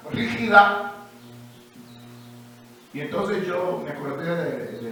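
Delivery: normal talking voice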